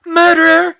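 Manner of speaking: high, loud raging scream; furious scream. Acted. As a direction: scream it with pure rage. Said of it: "she screamed back".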